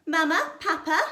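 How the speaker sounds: put-on high, loud sugary child voice; a spoilt girl calling her parents sweetly; little girl calling Mama and Papa. A narrator's reading. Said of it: "the girl said".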